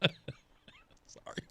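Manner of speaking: breathless laugh into an apology. Real, not acted. Host laughing at his own coffee shop joke. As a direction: crack up mid-sentence, then apologize. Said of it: "I'm laughing at the idea".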